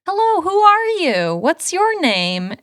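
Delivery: high, pushy introduction, easing at the end; mock screamed greeting. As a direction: imitate an over-eager girl accosting a stranger. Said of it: "screaming at him like".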